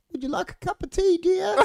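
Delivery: put-on high, sweet old lady asking. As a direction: imitate a doting old lady offering tea. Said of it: "and they're like".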